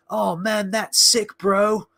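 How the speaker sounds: mock the blue aliens like a stoner surfer; surfer dude. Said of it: "really just surfer dude kind of talk".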